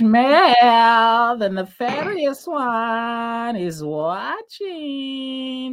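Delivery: high, very slow spooky voice; ominous announcer. Acted. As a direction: announce it slowly and eerily.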